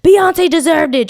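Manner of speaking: slow, high, loud call-out; retold yell. Audience member yelling at the Grammys, imitated loud shout. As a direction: holler it from the back of the crowd. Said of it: "somebody from the audience yelled out".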